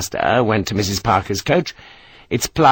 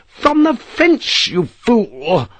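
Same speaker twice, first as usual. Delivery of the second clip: very high, drawn-out scornful shout; matron's scornful bellow; 11 semitones higher, much slower than usual. Acted. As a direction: bellow at him from the coach. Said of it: "Missus Parker bellowed".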